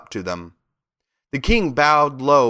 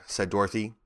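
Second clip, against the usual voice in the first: ordinary delivery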